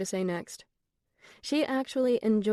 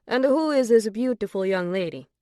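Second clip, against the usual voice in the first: put-on louder, delighted Italian accent; 3 semitones higher, 5 dB louder than usual. A narrator's reading. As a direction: charm her with a thick Italian accent. Antonio voiced with Italian accent.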